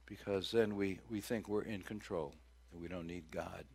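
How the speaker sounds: ordinary talk, nothing marked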